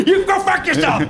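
high manic yell; unhinged villain. Retold or imitated. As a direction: scream it like a madman. Audience member shouts, De Niro.

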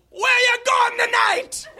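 high shouted Jamaican cabbie; Jamaican accent. Acted. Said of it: "admiring my Jamaican accent".

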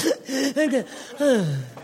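long snorting gasp, then exhale; exaggerated startled intake of breath; waking gasp. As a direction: act out jolting awake from a nod-off. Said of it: "come back and go".

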